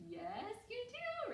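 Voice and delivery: high, drawn-out singsong confirmation; cheering praise. Real, not acted. Teacher confirming pupils heard the sound, cheering singsong. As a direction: sing it out slowly and high, delighted.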